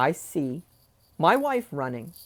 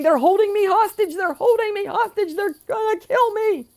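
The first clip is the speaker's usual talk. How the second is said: panicked cry; 4 dB louder than usual. Retold or imitated. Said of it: "He's saying".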